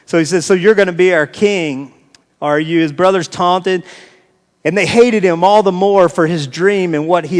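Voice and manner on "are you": ordinary talk, nothing marked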